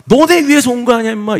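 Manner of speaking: high, loud indignant yell; imagined angry outburst. Retold or imitated. Pastor inwardly answering those who said go home, indignant yell. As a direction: shout it back as an exasperated protest. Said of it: "속으로는 막".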